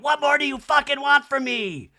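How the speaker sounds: high, loud acted exasperation; hammed-up exasperated yell; Kyle yelling. Kyle with forehead vein popping, exasperated mock yell. Kyle's outburst acted again.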